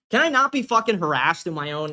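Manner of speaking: ordinary talk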